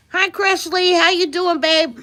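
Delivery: high, loud hello to a friend; bright greeting. Real, not acted. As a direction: call out a pleased greeting. Greets a viewer joining.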